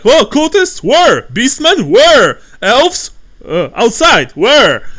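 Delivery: loud, high frantic calls; panicked patrons. Acted. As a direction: spin around looking for the threat. Panicked patrons looking around.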